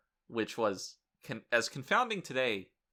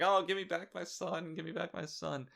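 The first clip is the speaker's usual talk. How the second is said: mock crying; 7 semitones higher than usual. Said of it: "just crying like".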